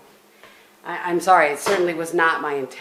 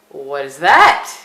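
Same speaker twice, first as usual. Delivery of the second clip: louder, slow, eager "What is that?"; drawn out like a curious husband; Bob's excited question; 3 semitones lower, 11 dB louder, much slower than usual. Retold or imitated. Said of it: "Bob ... said".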